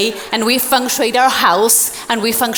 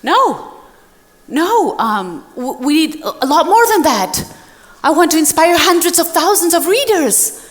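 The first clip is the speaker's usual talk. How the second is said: pleading reply; 6 semitones higher than usual. Retold or imitated. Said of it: "And I go".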